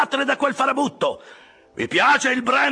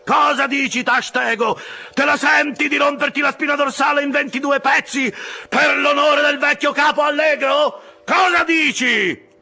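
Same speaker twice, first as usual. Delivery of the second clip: building to a very high, loud holler; rousing yell; 6 dB louder than usual. A narrator's reading. Officer demanding more from his oarsmen, yell rising to full force. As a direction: drive the rowers on, ending at full voice. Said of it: "urlò Stubb".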